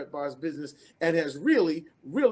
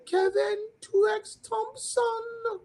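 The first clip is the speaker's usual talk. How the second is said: much higher, slow Caribbean lilt calling out a name; charming Caribbean accent; much slower than usual. Retold or imitated. Woman at an event spots Kevin, calling in a high Caribbean accent. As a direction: imitate a delighted Caribbean woman spotting a star. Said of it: "in the most charming Caribbean accent".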